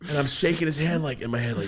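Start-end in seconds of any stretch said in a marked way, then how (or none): none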